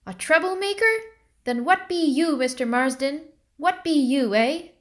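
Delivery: outraged shouting back; indignant outburst. A narrator's reading. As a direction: leap up and fling it back at him. Liddy leaps up indignant.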